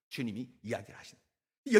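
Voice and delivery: ordinary talk, nothing marked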